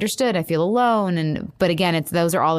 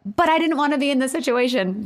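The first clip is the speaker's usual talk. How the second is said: higher, whiny inner voice; unspoken inner complaint; 9 semitones higher than usual. Retold or imitated. Wife voices the part she left out of her apology, higher and whiny. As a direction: voice the thought she kept to herself, plaintive. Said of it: "it's just like".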